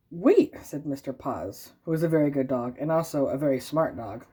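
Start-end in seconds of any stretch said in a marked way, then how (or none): 0.1-0.5 loud, high "aha"; sudden realization. Acted. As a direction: burst in with an idea. Dog interrupts.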